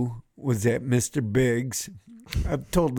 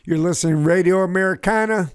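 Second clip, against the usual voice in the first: louder, fast announcer read; radio ident; 3 semitones higher, 5 dB louder than usual. Acted. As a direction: punch the station name like a DJ.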